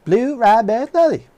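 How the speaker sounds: loud, high imitation of a Southern accent. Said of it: "he had this really strong Southern accent".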